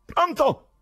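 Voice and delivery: high, loud calling; shrieked high phone call; shouting into phone. A narrator's reading. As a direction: yell to be heard down the line. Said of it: "gridò di nuovo".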